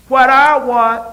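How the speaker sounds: put-on a sudden loud, high child's cry; childish demand. The older baby demands at the breakfast table, loud and high. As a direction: belt it out like a spoiled toddler.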